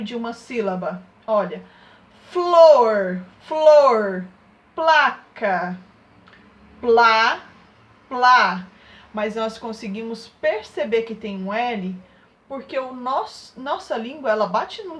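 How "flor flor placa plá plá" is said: flor, placa and plá loud and exaggerated, the L glued to the consonant; sounding out blends. Shown as a demonstration. Teacher showing second graders the L in the middle of a syllable, loud. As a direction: belt each word to show the hidden L. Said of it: "quando ele está intrometido no meio".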